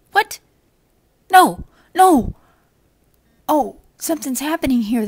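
sudden high, loud alarmed cry, then fast; an imp caught off guard, panicking; startled imp. Acted. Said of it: "the imp will say".

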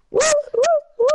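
cheering woo. Real, not acted.

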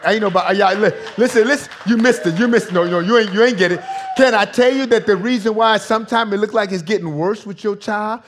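loud, insistent pulpit delivery; forceful preaching. Real, not acted. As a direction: press the point urgently on the congregation.

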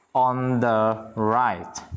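"on the right" stretched out word by word; very slow model phrase. Shown as a demonstration. Teacher giving students the translation, very slow and deliberate. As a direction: draw out "on", "the" and "right" separately.

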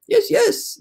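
very high, excited agreement; storybook group enthusiasm; eager chorus. A narrator's reading. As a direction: squeal assent like an impatient crowd. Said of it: "they chorused".

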